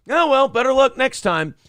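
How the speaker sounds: high breezy shrug-off; mock dismissive. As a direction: shrug it off mockingly. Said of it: "they'll go".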